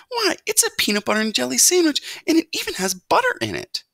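high, loud, amazed mother mouse; marveling. A narrator's reading. Mother mouse inspects sandwich, amazed. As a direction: gush in wonder, voice way up. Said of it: "she marveled".

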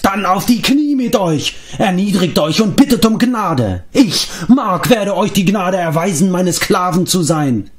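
high, grandiose ranting; crazed shout. A narrator's reading. Mark commanding the companions to kneel, shouting high. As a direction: demand that they grovel. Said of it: "schrie Mark".

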